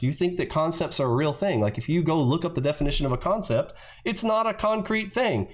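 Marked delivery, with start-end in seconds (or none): none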